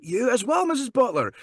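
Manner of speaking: high-pitched, polite Scottish lilt; put-on Scottish accent. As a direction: charm her in a fake Scottish accent. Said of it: "do your Scottish accent".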